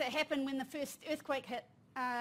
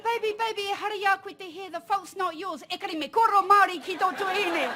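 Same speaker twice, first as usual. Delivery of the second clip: higher, louder reassuring mum voice; mother reassuring; 5 semitones higher, 8 dB louder than usual. Retold or imitated. Mother telling her son the fault is not his, high and reassuring. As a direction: reassure the boy, then turn it into an order. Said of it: "I said".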